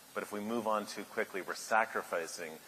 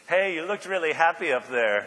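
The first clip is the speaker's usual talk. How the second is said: higher, louder imitated Italian accent; thick Italian accent; 7 semitones higher, 7 dB louder than usual. Italian chef teasing the dejected restaurateur, thick Italian accent. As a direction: call out with a hammed-up Italian accent. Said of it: "in this very thick Italian accent".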